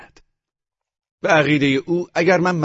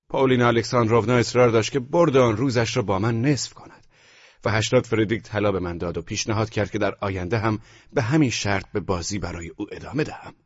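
ordinary delivery

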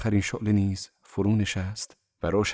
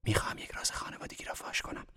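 very soft, quick confidential whisper; eager whisper; 12 dB quieter, faster than usual. A narrator's reading. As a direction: whisper it keenly, like sharing a secret. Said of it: "با شوق و شور به نجوا گفت". (نجوای پرشور و رازگونه)

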